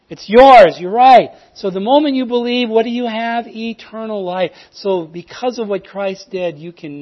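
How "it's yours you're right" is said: loud, high and emphatic; emphatic affirmation. Retold or imitated. Evangelist loudly confirming the listener's correct answer.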